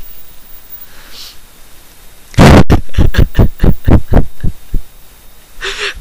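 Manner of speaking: loud high fit of real laughter; real laughing fit; helpless laughter. Real, not acted. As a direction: burst out laughing at the text. Reader cracks up at text.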